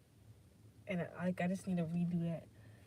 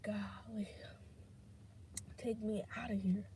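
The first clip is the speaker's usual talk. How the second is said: whispered, as if to herself; retold whisper; 8 dB quieter than usual. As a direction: breathe it out under your breath. Retold thought in despair.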